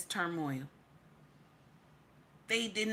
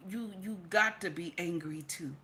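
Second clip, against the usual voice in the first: normal talking voice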